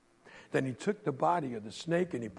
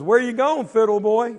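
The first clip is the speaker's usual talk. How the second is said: loud taunt; 7 semitones higher, 11 dB louder than usual. Acted. Quentin taunts Henry.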